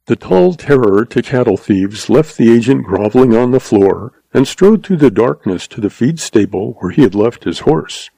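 plain reading voice

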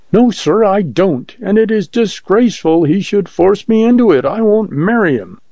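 high-pitched protesting bride; indignant refusal. Acted. Bride indignantly refuses forced marriage.

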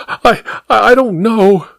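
high, halting, near tears; starting to cry. A narrator's reading. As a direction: voice breaking, slow and hesitant. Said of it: "She began to cry".

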